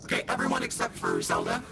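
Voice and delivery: very low, fast monster voice; creepy deep pumpkin growl; pumpkin head. Acted. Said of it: "I've been this pumpkin voice the whole time".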